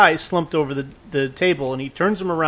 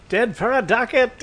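high, theatrical Hamlet voice with a scolding snap; much higher mock-theatrical Shakespeare delivery; hammy Shakespeare actor; 8 semitones higher than usual. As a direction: ham it up like a stage actor, then mock-scold. Mock Hamlet line, scolding cohost.

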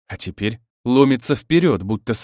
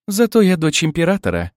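higher-pitched haughty comeback; parry back proudly in a raised pitch; defiant retort; 6 semitones higher, 4 dB louder than usual. A narrator's reading. Emperor's daughter answering her rival bride, defiant retort. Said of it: "парировала Злата".

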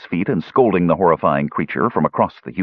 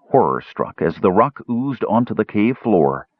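ordinary delivery, nothing marked

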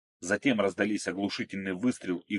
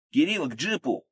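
high, urgent call; shouted command; 8 semitones higher, 3 dB louder than usual. A narrator's reading. Akulov sending his ally to the jeep, urgent shout. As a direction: call out urgently across a fight. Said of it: "крикнул Акулов".

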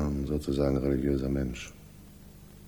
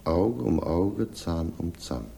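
slow, deliberate, every word set apart; slow weighty quote; slower than usual. Acted. Inspector quoting scripture at Gardner, slow and weighty. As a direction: lay the scripture down like a verdict.

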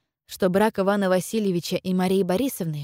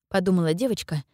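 plain reading voice

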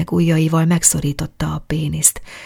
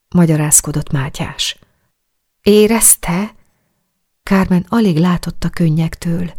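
ordinary delivery

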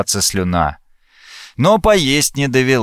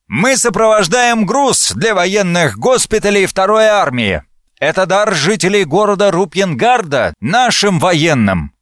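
lightly voiced officer, raised pitch; officer's announcement; 8 semitones higher than usual. A narrator's reading. Officer announces convoy from behind narrator. (объявление офицера, чуть приподнятым голосом)

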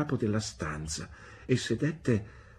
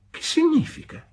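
put-on high, louder child's question; puzzled little boy; 6 dB louder than usual. A narrator's reading. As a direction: pipe up in a high boy's voice. Boy questions the stranger's remark.

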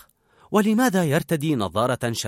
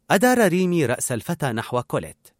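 usual speaking voice

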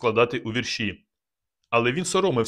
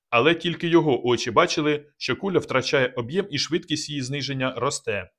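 ordinary delivery, nothing marked

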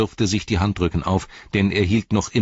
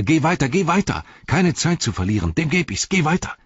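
high, breathless urging; panting urgency; 10 semitones higher than usual. A narrator's reading. Sam drives his master onward against Gollum, panting urgency. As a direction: gasp it out, hurrying him along. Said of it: "keuchte er".

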